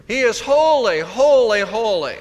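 first holy high and loud, each holy falling after; emphatic triple holy. Shown as a demonstration. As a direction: hit every holy, fading down the three. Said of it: "it's for emphasis".

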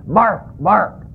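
playful mock barking; dog bark. Talking dog joke punchline.

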